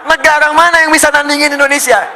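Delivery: high, full-force challenge; defiant rousing shout. Real, not acted. Preacher daring any country to rival Indonesia, high full-force shout. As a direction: roar the question out to the hall.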